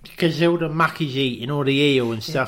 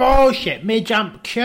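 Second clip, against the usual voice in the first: much higher, louder brash refusal; mock Kim defiant; 8 semitones higher, 6 dB louder than usual. A pal mimics Kim refusing the steward, loud and high. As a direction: snap it back defiantly. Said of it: "He went".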